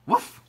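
short, loud imitated bark; dog woof. A narrator's reading. Ebb to the baby seal, woofing. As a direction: give one quick woof. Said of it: "said Ebb to the baby seal".

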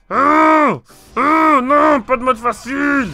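high loud groaning dismay; real dismay. Real, not acted. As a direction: whine at no easy mode. No easy mode in game.

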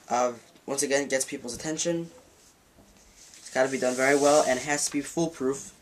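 ordinary delivery

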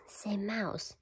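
ordinary delivery

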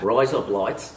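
put-on Australian accent. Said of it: "saying razor blades in Australian".

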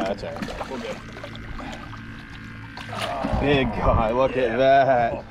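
ordinary delivery

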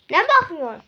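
high, loud "what are you looking at"; angry yell. Real, not acted. Big brother yelling at staring little brother, loud.

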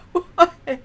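high, loud laughing question. Real, not acted. Gamer still laughing at the jokes, high laughing why. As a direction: squeak it out mid-laugh.

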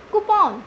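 Coupon as "koo-PAWN", high then falling; open, long second syllable in Coupon, "koo-PON"; correct pronunciation. Shown as a demonstration. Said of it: "Revise with me". (Coupon को "कूपॉन" बोलें)

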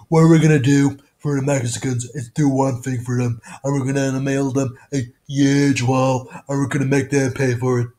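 brash campaign-rally voice; Donald Trump. Shown as a demonstration. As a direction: boast it like a rally promise. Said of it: "Donald Trump".

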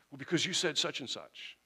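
hammed-up aggrieved protest; all upset. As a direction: act out an upset caller's accusing reply. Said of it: "if you call late at night all upset".

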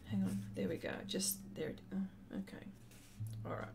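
breathy whisper while adjusting the camera; whispered to self. Real, not acted. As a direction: mutter it under your breath, half to yourself. Fiddling with camera setup.

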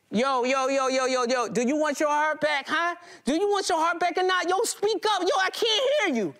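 high, mocking thug on the phone; taunting caller. Robber phoning the narrator about his heart, high mocking taunt. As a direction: taunt him down the line.

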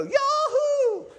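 high, loud whoop; whooping yell. Retold or imitated. Pastor imagining a boater's yell, a high loud whoop. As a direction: let out a big celebratory holler. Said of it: "I'm waiting for him to go".

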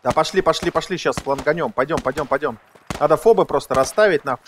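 ordinary talk

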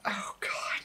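breathy dreading whisper. Acted. Narrator whispering in dread about what he will say. Dreading his own next line.

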